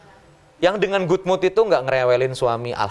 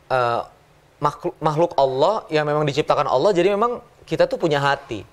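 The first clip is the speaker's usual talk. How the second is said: normal talking voice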